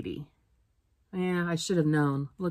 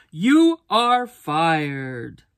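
slow, louder firing; stern yell; 6 dB louder, much slower than usual. A narrator's reading. The boss firing the new lunch lady, slow and loud. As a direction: spell it out slowly and loud, a boss's verdict. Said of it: "he yells".

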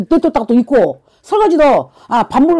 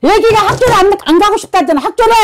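raised, high-pitched insistence; heated confrontation; 8 semitones higher, 3 dB louder, faster than usual. Real, not acted. Shaman confronts a mother about her child refusing school, heated and high. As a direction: press the point home, high and loud.

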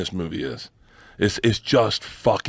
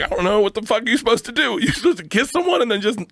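higher, rattled rant; a hint of mock panic, pitched up; confused panic; 12 semitones higher than usual. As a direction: sputter it out, baffled. Retelling confused panic on set.

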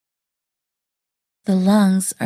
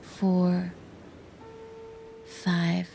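soft, steady count with long pauses; guided breathing count; 8 dB quieter, much slower than usual. Shown as a demonstration. Narrator demonstrates the breathing process to listeners, soft spaced guided count. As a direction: count out each breath softly, evenly spaced. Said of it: "The breathing process goes like this".

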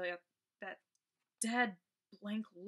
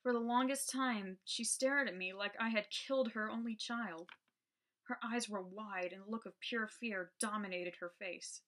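usual speaking voice

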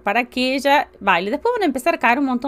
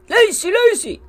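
higher, louder mock scolding of lazy; mock insult; 9 semitones higher, 5 dB louder, slower than usual. Shown as a demonstration. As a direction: fling it like an insult at the family. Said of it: "Y suena como que te estoy insultando". (gritarlo como un insulto en broma)

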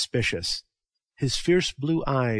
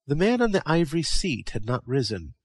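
ordinary delivery

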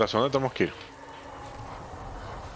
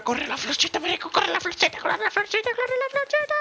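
higher and higher squeal each time; excited chasing cry. Pretend. Gamer chasing a fleeing flower, squealing higher and higher.

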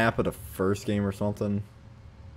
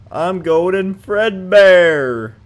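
slow, loud, high proclamation; dramatic announcer; 9 semitones higher, 10 dB louder, much slower than usual. Pretend. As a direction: boom out the character's name. Announces character name.

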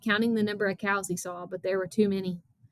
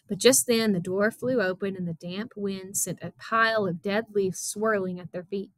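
ordinary delivery, nothing marked

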